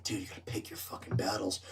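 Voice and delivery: confidential, near-whispered counsel; hushed advice. Retold or imitated. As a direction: talk him down under your breath. Quietly talking down a drunk customer.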